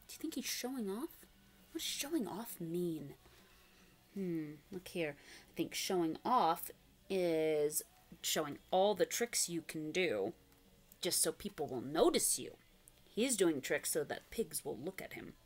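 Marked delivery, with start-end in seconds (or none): none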